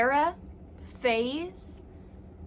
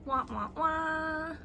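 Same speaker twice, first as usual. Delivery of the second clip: mock sad trombone; 5 semitones higher, 4 dB quieter, slower than usual. Sad trombone after book tally.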